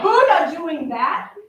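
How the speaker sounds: ordinary delivery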